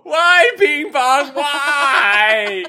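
high, drawn-out, loud mock wail; hammed-up grief, cry it out slowly; mock bawling. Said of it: "just bawling".

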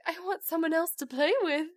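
high, lightly voiced little boy; pleading child. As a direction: plead like a small son. Voices young son's plea.

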